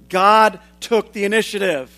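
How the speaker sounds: usual speaking voice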